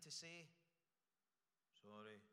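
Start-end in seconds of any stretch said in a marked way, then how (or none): 1.8-2.2 mumbled low, soft "sorry" like a caught schoolboy; a hint of a sheepish kid, head down; sulky schoolboy. Caught schoolboy answering the teacher, mumbled low and soft. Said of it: "like the schoolboy who's been caught".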